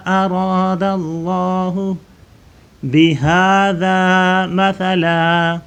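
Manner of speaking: melodic recitation, drawn out; Quran recitation. Acted. Teacher recites the verse for pupils, melodic chant.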